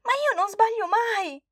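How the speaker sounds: high, loud mock-sobbing child cry; sobbing protest. Girl sobs to grandma she never makes mistakes, high loud cry. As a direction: wail it through sobs. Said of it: "rispose Flavia tra i singhiozzi".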